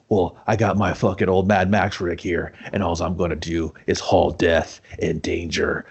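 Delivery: low voice, slowing for the last words; tough-guy hero. Retold or imitated. Hawk announcing his plan in his rig, low tough-guy voice. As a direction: play a mock action hero, low and deliberate.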